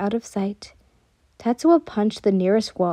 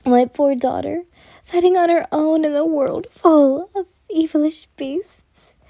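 higher, mournful worry; sighing lament; 6 semitones higher than usual. A narrator's reading. Mother sighing over her missing daughter, mournful lament. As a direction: lament it with theatrical pity. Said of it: "Hano-sama sighed".

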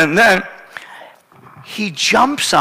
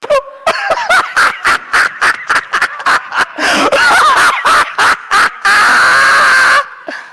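held-in giggles bursting out, very high; splutter, then burst into shrieking laughter; uncontrolled laughing fit; 4 dB louder than usual. Retold or imitated. Pastor acting out his giggles at fighting couple, helpless laughter. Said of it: "And I start to laugh".

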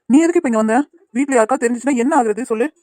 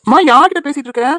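louder and higher, calling across the house; 5 semitones higher, 7 dB louder than usual. Acted. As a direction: call to mother from another room. Son calls out to mother.

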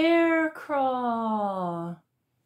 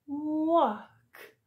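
normal talking voice

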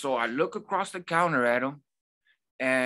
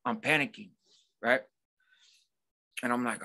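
usual speaking voice

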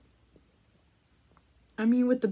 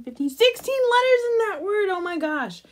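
amazed exclaim; 11 semitones higher, 5 dB louder than usual. Real, not acted. Amazed at letter count.

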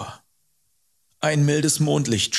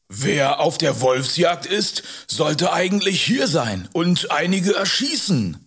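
higher, emphatic character voice; Hubert, pointed remark; 6 semitones higher, 4 dB louder than usual. Acted. Rider remarks on the missing wolf hunter, pointedly.

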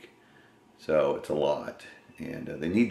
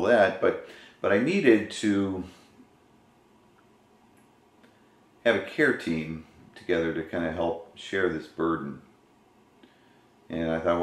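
talking around a cigar; cigar in mouth. Real, not acted. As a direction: lips pursed on cigar. Seen 1: Hand holds cigar at lips throughout the labelled words.

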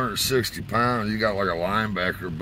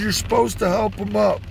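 higher, louder lecturing voice; Bobby scolding; 11 semitones higher, 3 dB louder than usual. Retold or imitated. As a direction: lay down the mosh pit rules. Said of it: "He goes".

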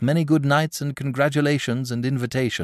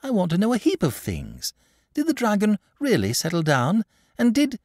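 put-on high, curious child's questioning; acted little girl, pitched well up; eager cry; 11 semitones higher than usual. A narrator's reading. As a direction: pester eagerly for more of the tale. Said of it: "cried Charlotte".

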